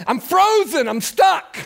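high, loud helpless cry; hammed-up alarm about a frozen computer; mock panic. Pastor calling for computer help.

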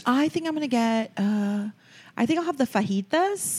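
put-on high American voice over-accenting Spanish "fajitas"; mock American putting Spanish accent on fajitas.

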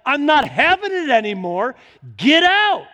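acted angry shout, high and loud; mad at devil. Believer ordering the devil out, acted angry yell. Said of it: "Get mad at the devil".

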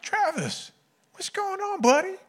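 very high-pitched coach impression; comic squeaky check-in from a coach; Coach Rosine. Coach walking in on his upset player, high comic voice. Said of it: "this is how Coach Rosine walks into a room".

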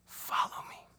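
whispered Follow me; Jesus calling Peter in a whisper. Acted. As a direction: breathe the invitation as a whisper.

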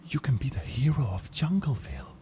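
whispering. Acted. Whispered eager promise.

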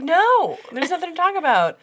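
high, loud start, then easing off; exaggerated fed-up dismissal; rude refusal. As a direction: imitate her shutting him down. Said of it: "I'm being ruder than she was".

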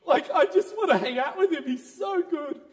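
high, laughing delight; laughing joy. Real, not acted. Preacher laughing with joy about the Lord. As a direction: giggle through it with joy.

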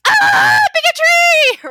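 high, loud mock cry of alarm; mock alarmed shout. Mocking her own reflex to a Southern accent, hammed-up shout. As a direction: blurt it out like a hammed-up panic alarm. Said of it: "and I'm like".

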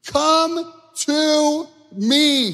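high, loud, stretched-out call; soaring preacher's shout, words held long; preaching Jesus' invitation. Acted. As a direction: call out to the weary at full voice. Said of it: "Jesus says this".

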